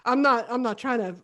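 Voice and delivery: high, loud squeamish "ooh, uh-uh"; creeped-out refusal. Retold or imitated. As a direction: recoil with a nope. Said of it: "and I was like".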